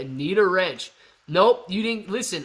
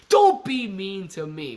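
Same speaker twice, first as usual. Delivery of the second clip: mock plea; 3 semitones higher, 5 dB louder, slower than usual. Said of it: "And if he says".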